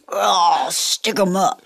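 tough-guy gangster voice. Retold or imitated. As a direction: growl it like an old gangster. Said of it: "What was that voice he's doing?".

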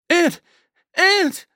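high, full-force scream; screamed name. A narrator's reading. Friend calling to wounded man on stairs, screaming.

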